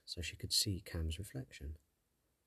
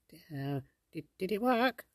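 whispering fairy; 10 semitones higher, 3 dB louder than usual. Acted. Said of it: "the sprite whispered".

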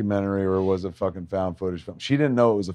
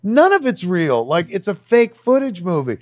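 exasperated explaining; 12 semitones higher than usual. Retold or imitated. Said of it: "And I'm like".

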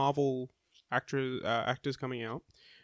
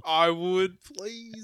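mock eager; 6 semitones higher, 6 dB louder, much slower than usual. Mock eager reply to catchphrase.

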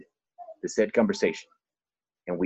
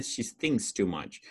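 ordinary talk, nothing marked

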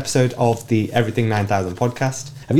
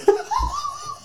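burst of laughter; 4 dB quieter than usual. Real, not acted. Host laughing at his friend's charity claim, disbelieving laugh.

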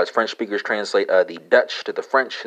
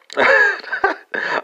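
real high laugh. Real, not acted. Joke about coups in America.

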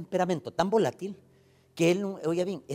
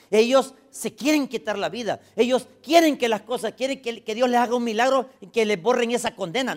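loud, high, driving pulpit preaching; preaching at full force; 8 semitones higher, 9 dB louder than usual. Acted. Pastor preaching loudly about prisoners and guilt. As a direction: thunder it at the congregation.

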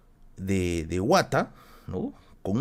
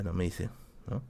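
ordinary talk

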